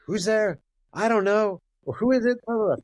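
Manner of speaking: high, loud yelling through the door; muffled family shouting match behind the door. Retold or imitated. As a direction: shout it high from inside the house. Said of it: "I hear them, like, yelling at each other".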